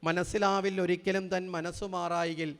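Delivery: slow, measured chanting of the verse; poem recital. Shown as a demonstration. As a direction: recite the verse line calmly, unhurried. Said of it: "എഴുതി വച്ചിരിക്കുന്നത്".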